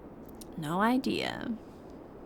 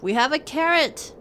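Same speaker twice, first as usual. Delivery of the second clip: ordinary talk, nothing marked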